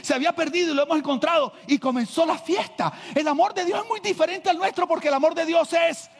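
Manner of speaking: high, loud fervent preaching; preaching at full force. Acted. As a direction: preach it out to the whole church. (predicando a todo pulmón)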